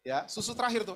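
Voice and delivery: ordinary delivery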